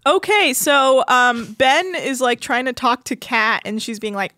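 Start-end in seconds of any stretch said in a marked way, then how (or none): none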